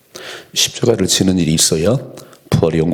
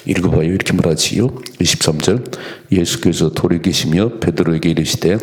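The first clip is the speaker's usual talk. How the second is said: ordinary talk, nothing marked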